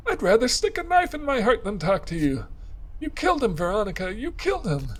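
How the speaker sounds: high, grief-stricken woman's voice; tearful accusation. Acted. Clarissa accusing Veronica of killing Malcolm, high and tearful.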